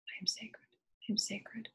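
soft, breathy whisper of self-affirmation. Real, not acted. Speaker leading listeners in affirmation, hushed whisper. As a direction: whisper it to yourself like a prayer.

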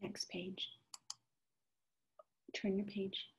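soft unvoiced whisper. Real, not acted. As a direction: whisper the instruction. Quiet page-turn cue.

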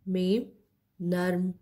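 ordinary talk, nothing marked

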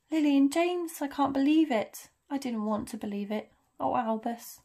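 starts high and loud, sinks in grief; distraught. Acted. McGonagall grieves Lily and James to Albus, distraught. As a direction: stricken disbelief fading away.

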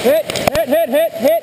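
high, loud repeated hit call. Real, not acted. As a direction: shout hit to stop fire. Calling out being shot.

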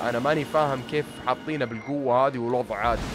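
ordinary talk, nothing marked

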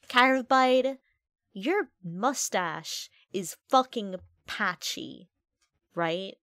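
drawn-out taunting insult; slow mock roast. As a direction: spell out the insult slowly.

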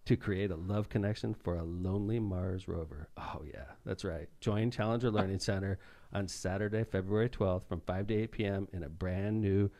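seduce the listener with sponsor copy; sexy voice. Pretend. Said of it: "I'm gonna do it in a sexy voice".

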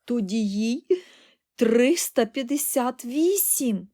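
loud, high, drawn-out figure; nearly choking amazement. A narrator's reading. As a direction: blurt it out astounded. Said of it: "мало не захлинувся Чарлі".

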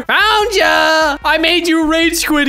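high, drawn-out triumphant call; pumped-up gamer taunt; gloating taunt. Acted. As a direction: crow it at the players you hunted down. Taunts players on rejoining lobby.

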